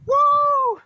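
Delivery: high, loud woo; whoop. Real, not acted. Angler cheering as the catfish swims off, loud. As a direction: celebrate the release. Releasing the fish.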